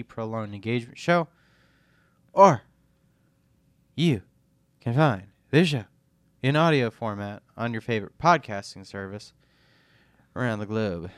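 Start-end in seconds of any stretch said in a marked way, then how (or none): 2.3-11.1 blocked sinus voice; nasal from a sinus infection; stuffy nose. Real, not acted. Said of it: "my voice is kinda off".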